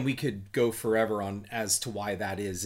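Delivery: ordinary delivery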